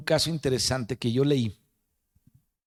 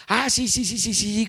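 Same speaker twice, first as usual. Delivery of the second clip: eager recall; 10 semitones higher than usual. Retold or imitated. Doctor eagerly recalling formula.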